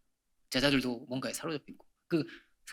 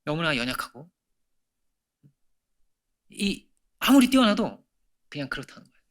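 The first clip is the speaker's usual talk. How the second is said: ordinary talk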